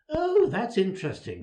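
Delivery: overdone politeness, not really interested; feigned interest. A narrator's reading. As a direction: feign interest with a high-pitched "oh". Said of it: "which means, of course, that she wasn't at all interested".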